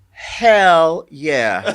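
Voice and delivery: loud, eager hell yeah; excited. Retold or imitated. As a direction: blurt it out thrilled. Retelling his eager reply.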